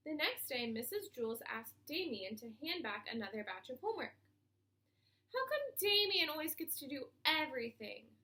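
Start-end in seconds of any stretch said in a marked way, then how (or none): 5.3-8.2 louder whiny complaint; griping. A narrator's reading. Kathy gripes to the teacher about Damien, whining. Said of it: "griped Kathy".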